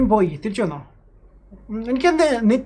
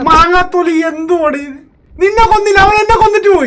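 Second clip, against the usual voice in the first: loud, high, outraged shouting; furious rant; 7 semitones higher, 8 dB louder than usual. Real, not acted. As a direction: vent after getting killed. Angry after being killed. (കൊല്ലപ്പെട്ടതിന്റെ ദേഷ്യത്തിൽ അലറുന്നു)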